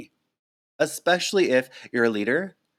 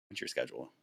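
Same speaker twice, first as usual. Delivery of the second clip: a much lower, soft mutter of "what's your schedule?"; quiet self-correction; 9 semitones lower, 14 dB quieter, faster than usual. Real, not acted. Vlogger murmuring to herself after garbling a word, quiet. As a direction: catch the slip under your breath. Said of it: "English hard today".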